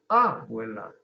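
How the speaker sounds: "abuela" as Á-buela, first vowel punched; wrong stress on A. Shown as a demonstration. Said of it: "y tampoco es abuela". ("abuela" acentuado al inicio, ábuela)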